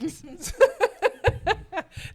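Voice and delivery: a burst of high laughter. Real, not acted. Agent laughing at her own story, high laughter. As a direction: laugh out at the memory.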